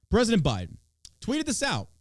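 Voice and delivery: normal talking voice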